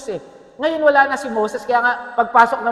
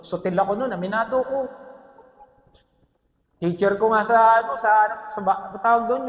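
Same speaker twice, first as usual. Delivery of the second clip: ordinary talk, nothing marked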